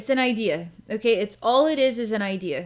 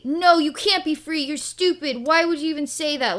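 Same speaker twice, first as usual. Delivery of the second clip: high, loud mock yelling; bullying angry man; 7 semitones higher, 5 dB louder than usual. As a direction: snap back like a scornful bully. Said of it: "he's being a bully".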